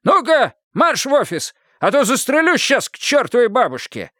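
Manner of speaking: high, forceful threatening order; march him off at gunpoint; decisive threat. A narrator's reading. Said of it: "решительно закончил он". (решительная угроза сторожа)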